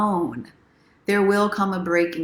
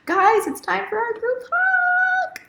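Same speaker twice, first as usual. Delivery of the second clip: much higher, slow, drawn-out call; sing-song announcement; slower than usual. Acted. Calling viewers to the group hug, sing-song. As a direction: announce it like a treat.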